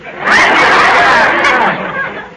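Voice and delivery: big high hearty laugh; loud, high belly laugh. Real, not acted. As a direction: burst out laughing at the joke. Laughs at George's joke.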